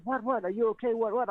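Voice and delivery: wife's alarmed what, what. Retold or imitated. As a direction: play the startled wife checking on him. Wife runs out alarmed.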